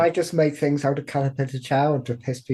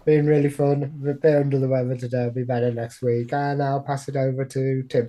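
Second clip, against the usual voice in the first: ordinary delivery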